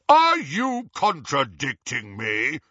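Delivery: slow, weighty, imperious challenge; blustering. A narrator's reading. Harry as Runcorn challenged by a wizard, blustering. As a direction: draw it out as an affronted superior. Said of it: "Harry blustered".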